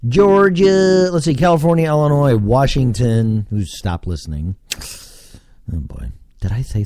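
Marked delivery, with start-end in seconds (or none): 0.1-3.5 high, loud state roll call; showy high announcing; announcer call-out. Acted. Announces states from his statistics.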